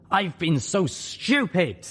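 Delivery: high, slow, drawn-out dismay; high-pitched lament at own foolishness; exasperated self-reproach. Acted. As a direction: berate yourself, stretching the words. Spike berating himself after realisation.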